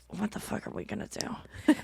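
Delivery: very soft, breath-only mock dismay; whispered inner thought. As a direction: whisper it like a thought kept from mom. Retells private dismay at mom's invitation.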